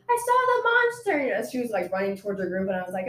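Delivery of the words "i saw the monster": high-pitched imitation of a screaming girl; mock scream. Student retelling a girl's monster sighting, mock scream. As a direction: squeal it like an overexcited witness, not full volume. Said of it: "she started screaming".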